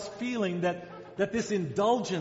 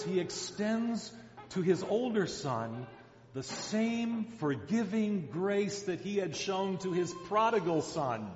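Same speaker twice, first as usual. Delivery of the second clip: ordinary delivery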